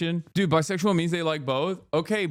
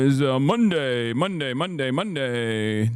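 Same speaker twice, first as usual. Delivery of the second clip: catchphrase delivery; slower than usual. Real, not acted. Drawn-out show-opening catchphrase.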